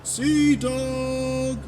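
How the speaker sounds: high, soft, long-drawn far-off call; distant calling. A narrator's reading. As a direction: stretch "Dog" out as if heard from afar. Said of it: "he called out".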